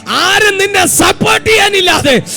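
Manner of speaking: high, loud, shouted exhortation; full-force preaching. Real, not acted. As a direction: preach at a shout, pitched far above normal speech.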